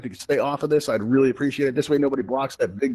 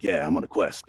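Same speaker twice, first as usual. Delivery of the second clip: cool boast; 8 semitones lower, 4 dB quieter than usual. Pretend. Host voicing a Quest user showing off, low and laid-back. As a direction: lower and softer, laid-back brag. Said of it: "And be like".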